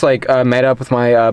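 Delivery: ordinary delivery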